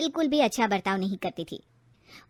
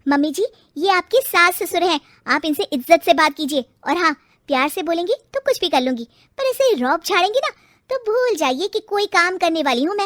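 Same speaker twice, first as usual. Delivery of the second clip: louder, higher defiant daughter-in-law; mother-in-law scolded by the bride, loud and defiant; arguing back; 5 semitones higher, 6 dB louder than usual. Acted. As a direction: stand up and lecture her. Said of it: "बहस करने लगती है".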